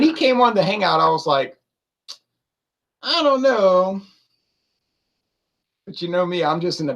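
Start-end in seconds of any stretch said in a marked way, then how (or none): 3.0-4.0 high, drawn-out musing; mildly skeptical, pitched well up; doubtful inner thought. Retold or imitated. Thinking to himself on the Hangout, doubtful. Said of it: "I'm thinking to myself".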